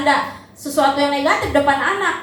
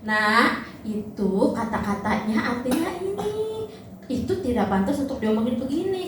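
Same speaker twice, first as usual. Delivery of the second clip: gentle explaining; 6 dB quieter than usual. Shown as a demonstration. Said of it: "Kita harus jelasin".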